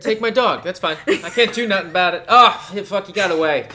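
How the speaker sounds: high, loud mock panic over a stolen dog; hammed-up dog owner. Joking about dog theft, a hammed-up high panicked yell.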